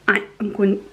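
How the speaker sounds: hoarse, voice giving out; croaky voice cracking on the words; lost voice. Real, not acted. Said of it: "sorry, I've lost my voice".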